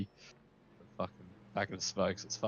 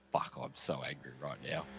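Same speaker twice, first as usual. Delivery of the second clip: muttered fury; 7 semitones lower, 7 dB quieter than usual. Retold or imitated. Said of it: "I'm like".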